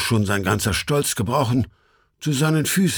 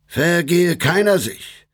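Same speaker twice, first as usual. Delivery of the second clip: slow, raised call to the devils; 7 semitones higher, slower than usual. A narrator's reading. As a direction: call them off firmly. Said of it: "Er aber rief".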